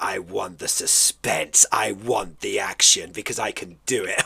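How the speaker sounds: soft low parody of an intense answer; imitated enthusiasm. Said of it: "I love how you say it with such enthusiasm".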